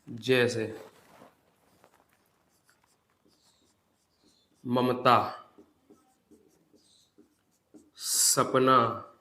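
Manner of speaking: slow, spaced dictation for notes; dictating to students. Acted. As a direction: read it out word by word for writing down.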